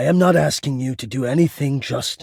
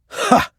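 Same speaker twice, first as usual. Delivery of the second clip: a single louder barked "ha"; short sharp laugh; 5 dB louder than usual. Acted. Musing on being unhinged, one abrupt laugh. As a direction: catch yourself with a sudden laugh. Sudden bitter laugh mid-thought.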